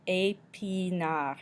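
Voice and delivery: "Épinard" slowly stretched as ay, pee, nar; slow teaching demo of "Épinard"; syllable by syllable. Shown as a demonstration. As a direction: draw out each syllable of "Épinard". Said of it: "makes the A".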